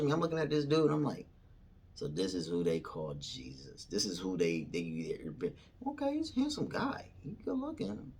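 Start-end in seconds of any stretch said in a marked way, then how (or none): 1.9-5.5 low, soft musing; retold inner monologue, hushed and low; thinking to oneself. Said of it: "thinking, talking". 5.9-8.1 high, soft little squeak; quiet, pitched-up retold thought; approving inner voice. Retold private appraisal of Jesus.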